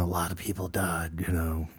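hushed, half-whispered retold line; hushed quote. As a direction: drop to a near whisper for the quote. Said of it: "he does ask the president".

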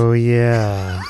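sly, knowing oh yeah. Real, not acted. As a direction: say it like you remember the disaster. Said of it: "Don't say it like that".

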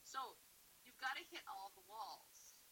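usual speaking voice

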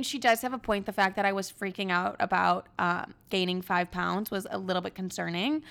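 ordinary talk

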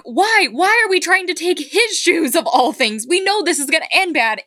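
high, pleading disbelief, retold; exasperated protest. Protesting the shoe theft to friends, retold exasperation. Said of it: "I'm just like".

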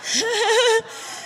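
squealing high laugh. Acted. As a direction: burst into a high giggle. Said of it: "I just wanna see people laugh".

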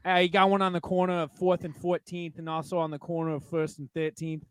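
mock East Coast, New York accent. Local giving street-corner directions, playful New York accent. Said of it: "That's New York".

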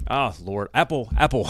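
ordinary talk

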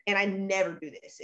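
ordinary talk, nothing marked

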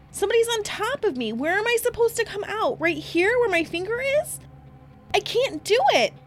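high, loud panicked pleading; Candace screaming. Retold or imitated. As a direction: beg in panic as if you're suffocating. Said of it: "Now Candace is screaming".

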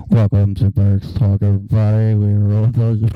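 muffled airline PA announcement; intercom voice. Pretend. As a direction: talk like a pilot over a crackly speaker. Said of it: "the intercom voice".

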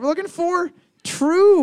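high, loud game-show call; host announcement. Acted. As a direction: belt out the answer like a host.

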